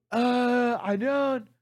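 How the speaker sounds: high, drawn-out hesitant refusal; clueless teen. Retold or imitated. Kid refusing to rent the man a paddle, mock clueless teen.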